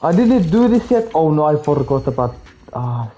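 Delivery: ordinary talk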